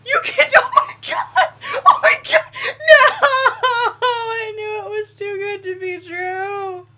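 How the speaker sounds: very high, shrieking laugh-cry; hysterical laughter. Real, not acted. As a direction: lose it completely laughing. Laughing at the crash.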